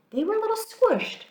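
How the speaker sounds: playful squeak. A narrator's reading. Squished blueberries, playful aside.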